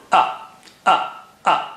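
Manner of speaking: a with a catch from the edge of the throat; Arabic hamza. Shown as a demonstration. Teacher demonstrating hamza for students. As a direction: stop the a at the throat. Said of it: "bottom or the edge of the throat".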